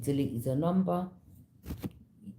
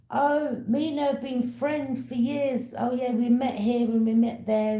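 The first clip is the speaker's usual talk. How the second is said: quoted man; 7 semitones higher, 4 dB louder than usual. Retold or imitated. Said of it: "he's sitting there saying".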